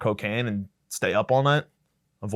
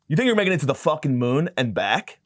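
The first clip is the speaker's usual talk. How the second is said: ordinary talk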